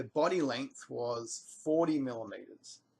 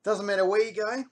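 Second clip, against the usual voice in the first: usual speaking voice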